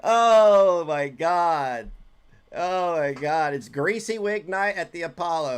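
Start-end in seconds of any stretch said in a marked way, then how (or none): 0.0-3.5 drawn-out disbelief. Real, not acted. Reacting to the clip in disbelief.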